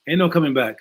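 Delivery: normal talking voice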